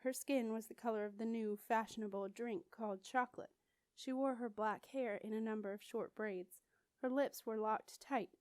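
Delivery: ordinary delivery, nothing marked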